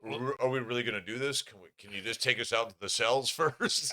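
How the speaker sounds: loud, high retold whine of complaint; mock pleading protest. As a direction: hurry out an exasperated plea, half laughing. Acted protest to cab driver.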